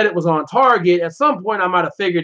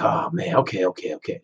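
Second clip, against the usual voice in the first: mock whisper; 12 semitones lower, 6 dB quieter than usual. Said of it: "So he was like".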